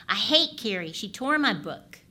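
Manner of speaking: put-on loud wailing five-year-old; mock-upset boy yelling; upset child. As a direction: play the furious little boy, loud. Child voiced in example story.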